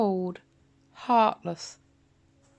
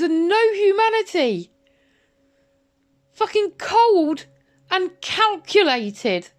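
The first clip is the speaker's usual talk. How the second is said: an enraged outburst, much higher and louder; furious shouting; 8 semitones higher, 6 dB louder than usual. Real, not acted. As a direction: shout it, bitter and outraged, drawing the words out. Furious rant at Martin.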